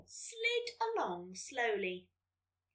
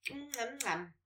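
soft munching "mm, mm, mm"; contented eating hum; munching noises; 7 dB quieter than usual. Acted. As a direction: chew through a big mouthful. Acts out snail munching lettuce.

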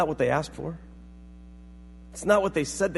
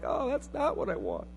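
higher, softer mock letdown; disappointed receiver; 7 semitones higher, 8 dB quieter than usual. Receiver looking at unwanted gift, softly mock-disappointed. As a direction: let it droop in soft, high disappointment.

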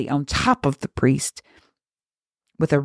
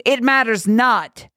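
higher-pitched, forceful declaration; emphatic dismissal; 7 semitones higher than usual. Acted. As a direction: cast it aside with force. Girl cuts off her own tirade.

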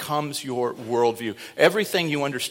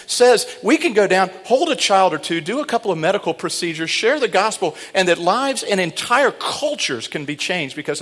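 loud, raised, fervent sermon delivery; full-force preaching; 7 semitones higher, 8 dB louder than usual. Acted. Pastor rousing the church about the Haiti trip, fervent and loud. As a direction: fire up the congregation.

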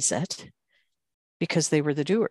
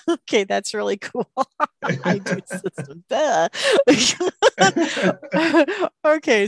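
high, loud laughter through the words; can't stop laughing at the pun; 10 semitones higher, 8 dB louder than usual. Real, not acted. Host surprised by pun, laughing.

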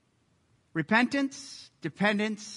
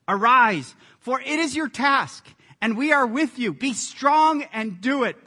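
loud, raised rallying call; rally them with a forceful charge; rousing command; 5 semitones higher, 7 dB louder than usual. Acted. Reading a rallying command aloud.

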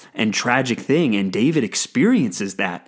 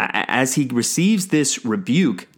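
ordinary talk

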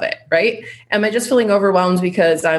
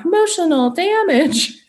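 much higher, slow, hammed-up meme shout; 11 semitones higher than usual. Tossing a meme line to viewers about her womb, high and slow. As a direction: drag it out like the famous "emotional damage" clip. Meme catchphrase tacked onto trauma.

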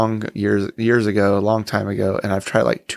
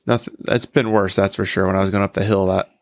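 usual speaking voice

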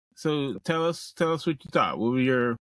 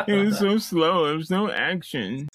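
high, louder mock Reddit complainer; 7 semitones higher, 3 dB louder than usual. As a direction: whine like an outraged commenter, hammed up. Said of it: "Oh, that's your Reddit voice".

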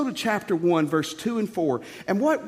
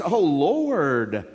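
drawn-out cry to the Lord; exaggerated prophet's groan of dismay; Habakkuk; 2 semitones lower than usual. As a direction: stretch LORD out in a long, swooping lament. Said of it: "I bet that's the way he said it too".

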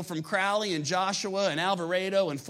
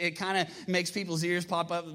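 normal talking voice

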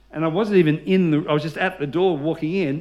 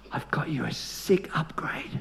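whispered secret; 8 dB quieter than usual. Retold or imitated. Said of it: "She said".

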